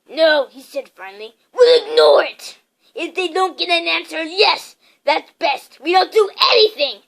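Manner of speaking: loud emphatic uncle; firm decision. A narrator's reading. Uncle Vernon tells his wife firmly, loud. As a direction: lay down the law.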